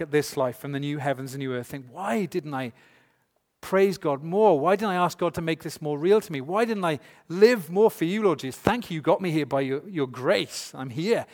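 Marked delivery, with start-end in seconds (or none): 1.9-11.3 pleading, swooping cry of hindsight; impassioned regret. Acted. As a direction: lament it to God with rising urgency. Acts out regretful prayer looking back.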